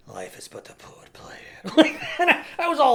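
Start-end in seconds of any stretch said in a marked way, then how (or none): 0.1-1.4 whispered, much lower and softer; whispered hammy Macbeth; hushed dramatic line. Retold or imitated. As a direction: breathe it out tragically. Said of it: "I stood up on the desk and I was like".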